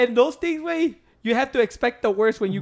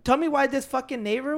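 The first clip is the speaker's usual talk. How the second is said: ordinary talk, nothing marked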